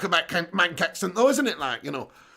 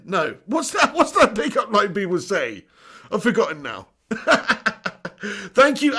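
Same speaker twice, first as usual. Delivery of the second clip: ordinary talk, nothing marked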